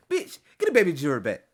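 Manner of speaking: loud mock demand breaking into laughter; imitated order, loud and amused. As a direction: bark it as the rapper, half laughing. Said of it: "I gotta read it in the fifty voice".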